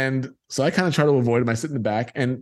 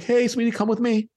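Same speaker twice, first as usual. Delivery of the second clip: lightly voiced young lady, pitched high; a hint of a sweet, higher-pitched woman's voice; friendly young lady; 9 semitones higher than usual. Young lady fetching a boy at the gate, lightly imitated friendly greeting.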